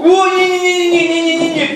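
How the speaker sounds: high, loud no-no-no; playful shouted no. Pretend. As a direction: refuse loudly and playfully. Refusing to eat the floor. (шутливый громкий отказ)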